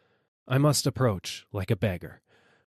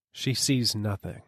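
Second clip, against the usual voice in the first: plain reading voice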